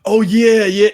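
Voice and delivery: loud, high burst of hype; loud, high excited holler; excited yell. Real, not acted. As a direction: shout it out, pumped at the memory. Reacting to Marvelous getting shook.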